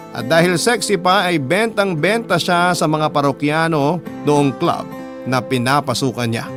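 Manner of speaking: ordinary talk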